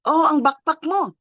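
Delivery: "backpack" in a Filipino accent, pitched high; demonstrating Filipino-accented "backpack". Shown as a demonstration. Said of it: "Very hard ang accent natin".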